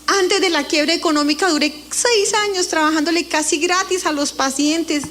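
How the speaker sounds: ordinary talk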